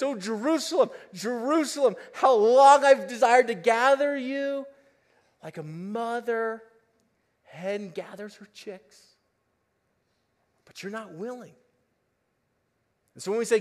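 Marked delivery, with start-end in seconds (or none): 0.0-11.5 lament. Acted. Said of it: "and he says".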